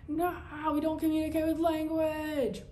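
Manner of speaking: high, drawn-out cry; hammed-up high wail; mock holler. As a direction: holler it back at a pursuing cop. Re-enacts yelling back at cop.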